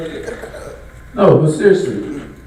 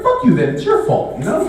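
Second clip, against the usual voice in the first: laughing retort; 9 semitones higher than usual. Retold or imitated. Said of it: "I'm like".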